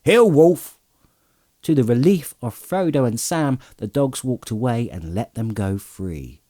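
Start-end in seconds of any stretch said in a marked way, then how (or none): 0.0-0.8 loud, high command to a dog; crying out. A narrator's reading. Farmer calling Wolf to heel, loud high shout. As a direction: order the dog to heel, loudly. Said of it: "he cried".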